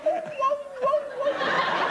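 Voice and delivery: very high yelping whoa; dog's whoa. Retold or imitated. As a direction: yelp like a speeding little dog. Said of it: "The dog was going".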